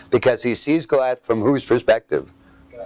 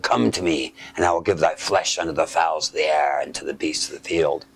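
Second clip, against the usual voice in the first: deep giant voice; 8 semitones lower, 3 dB quieter than usual. Acted. Goliath threatening David, low menacing giant voice. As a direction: lower and softer, a looming giant. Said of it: "he's gonna have a very deep, what? Voice".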